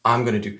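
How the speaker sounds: "I'm gonna do" with going to merged into gonna; short, run-together "I'm gonna do"; casual American reduction. Shown as a demonstration. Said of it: "ils vont plutôt dire".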